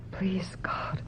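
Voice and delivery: a hushed, desperate please God; murmured prayer under the breath; whispered prayer. Acted. Whispered prayer for her mother.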